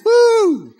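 high, thin mock "woo"; a lone high whoop, mocking a weak response; imitated crowd cheer. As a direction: mimic a meagre cheer from a few people. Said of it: "like, three people go".

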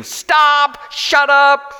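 high, loud shouted protest; screaming stop. Retold or imitated. Woman shouting at the hymn singer, loud scream. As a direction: yell at him to stop. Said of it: "continued to scream".

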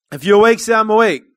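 rousing the congregation, loud; loud preacher call-out; loud call to the room. Real, not acted. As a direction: wake them up with a loud call. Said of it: "pastor rousing congregation, loud call".

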